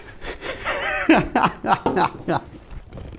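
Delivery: breathy burst of laughter at the mishap; startled laugh. Real, not acted. Maker laughing at his drilling mishap, surprised laugh.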